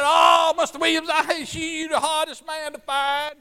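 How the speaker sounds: high, loud, eager cry of recognition; excited greeting. Retold or imitated. Family member spotting the preacher, excited loud greeting. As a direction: call out like you finally found him.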